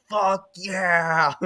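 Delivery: mock shout of joy, lightly voiced; retold shout. Fan recalls running outside, a mild shout of joy. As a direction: yell it out like in the street. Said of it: "just started shouting".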